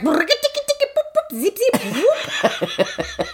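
high-pitched silly zips and whoop; mock frantic sound effects; imitated frantic honking. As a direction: make cartoon noises for nonstop honking. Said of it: "He can't stop. He's like".

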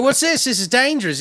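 high mock voice of a know-it-all; quoting a guy. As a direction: play him fussy and concerned. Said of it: "He's like".

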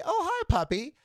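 high-pitched hello to a little dog; friendly puppy greeting. Retold or imitated.

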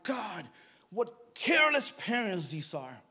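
slow, indignant outburst to God; exasperated complaint. Retold or imitated. Complaining to God about the boy's parents, exasperated. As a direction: drag it out, punching CARELESS.

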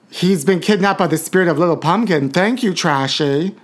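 ordinary talk

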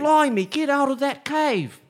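put-on high, loud, slow British soldier accent that slides into Australian; botched British accent. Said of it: "Oh, that's Australian".

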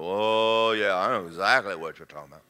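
low, nodding-along voice; knowing agreement. Acted. Preacher plays those who know what he means, low knowing agreement. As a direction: drop low and agree like an insider. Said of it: "nod and go".

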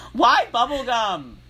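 high, loud, drawn-out wail of disbelief; slow, high exclamation of dismay; exasperated outburst. Real, not acted. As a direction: cry out in comic outrage.

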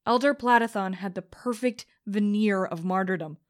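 usual speaking voice

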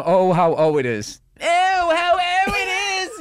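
very high, loud, stretched-out cry; mangled catchphrase yell. Retold or imitated. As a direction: yell it as a silly victory call. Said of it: "And then it became".

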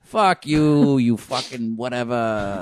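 mock Boston cursing. Imitating Bostonians swearing at everyone, lightly hammed Boston accent. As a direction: drag out a careless Boston curse. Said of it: "I'm sorry, Boston".

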